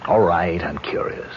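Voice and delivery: reluctant, breathless whisper; a hushed, grudging confession. Acted. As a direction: admit it under your breath, caught out. Grudging admission to ghost.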